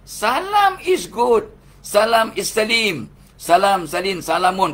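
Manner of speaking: high, loud and slow proclamation; booming praise of the word salam; emphatic declaration. Acted. Insisting salam is a good word.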